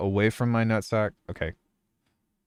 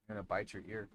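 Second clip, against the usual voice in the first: soft teasing; 18 dB quieter, much faster than usual. Real, not acted. Teasing the dog softly.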